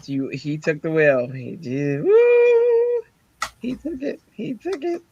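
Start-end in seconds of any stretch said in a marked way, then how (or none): 2.0-3.0 very high, loud "woo"; joyful whoop. Real, not acted. Praising God who took the wheel, joyful whoop. Celebrates being saved. 3.6-5.0 high, soft, delighted aside; squeaky praise. Real, not acted. Praising God who took the wheel, high soft glee.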